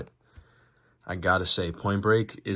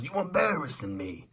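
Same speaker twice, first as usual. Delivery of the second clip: put-on Italian accent; 8 semitones higher than usual. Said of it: "like an Italian".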